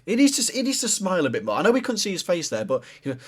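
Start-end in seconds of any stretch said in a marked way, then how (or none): none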